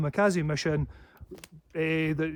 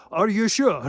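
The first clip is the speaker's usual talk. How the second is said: higher, louder quick challenge; 5 semitones higher, 8 dB louder than usual. Retold or imitated. Confronting a hostile man, loud and sharp. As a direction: snap the question at them. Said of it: "coming at me with hostility".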